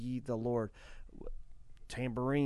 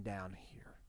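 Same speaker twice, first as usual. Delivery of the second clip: whisper; 3 semitones lower, 9 dB quieter than usual. Pretend. Imagined words spoken to God.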